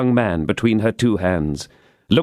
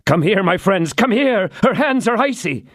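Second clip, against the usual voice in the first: alarmed call; 10 semitones higher than usual. Acted. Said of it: "exclaimed D'Artagnan".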